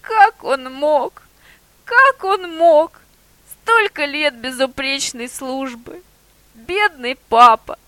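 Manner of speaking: slow, high broken lament; a dog's stunned slow lament; mumbling grief. A narrator's reading. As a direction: moan it to himself in disbelief. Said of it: "бормотал пёс".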